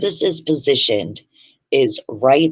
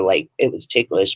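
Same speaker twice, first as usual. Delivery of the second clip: ordinary delivery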